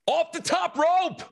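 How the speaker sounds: high, slow wrestling announcer call. Retold or imitated. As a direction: boom it out like a ring announcer. Kids announcing wrestling jump.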